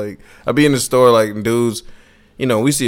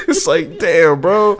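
usual speaking voice

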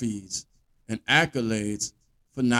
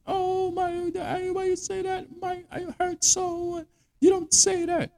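very high falsetto whine, hammed-up; mock crybaby. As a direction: wail like an over-sensitive drama queen. Mocking over-sensitive men's complaints.

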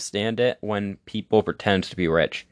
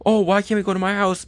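much higher, whiny mock voice; mock show-off guy; 11 semitones higher than usual. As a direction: plead like the guy with the run-down house. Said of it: "And she'll be like".

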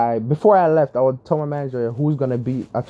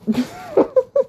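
high-pitched laugh; real laugh at her own story; laughter. Real, not acted. Storyteller cracking up over the coffee mix-up, laughing.

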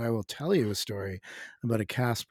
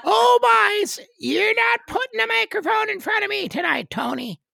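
very high, loud Newfoundland caricature; Newfoundland accent; 11 dB louder than usual. Acted. Newfoundlander grumbles to Tony about the microphone, high Newfoundland accent. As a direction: play a rural Newfoundlander with Ottawa Valley mixed in, high and loud. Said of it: "a little more Ottawa Valley with Newfoundland".

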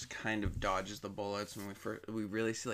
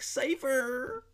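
much higher, louder whiny call of the name; whiny yell; 7 dB louder than usual. Retold or imitated. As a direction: wail it like a spoiled brat. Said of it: "whiny and cunty".

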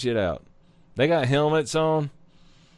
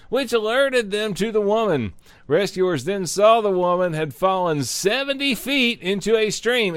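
news read; 4 semitones higher than usual. Acted. Reading news article aloud.

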